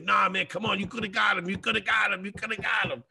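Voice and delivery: mock pep talk. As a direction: pump him up like a buddy in the wings. Friend pumping him up.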